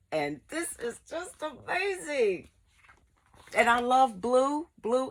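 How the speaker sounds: high-pitched gushing delight. Real, not acted. Vlogger admiring the blue book, high gushing. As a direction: coo over it, high and happy.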